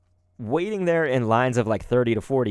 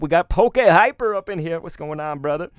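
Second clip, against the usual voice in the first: excited greeting; 5 semitones higher, 5 dB louder than usual. Real, not acted. Greeting a friend arriving.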